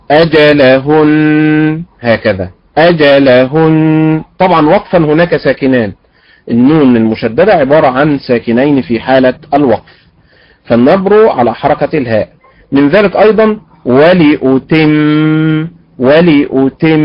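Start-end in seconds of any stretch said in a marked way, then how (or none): 0.1-4.2 أجلهن very slow, stress on the ha; Quranic stress. Shown as a demonstration. Teacher modelling the Quranic reading. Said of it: "في الأداء القرآني هكذا". 13.9-17.0 ولأتم slow, stress on the ta; slow stress demo. Shown as a demonstration. Teacher asking students where the stress falls. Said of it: "أين النبر في هذه الكلمة".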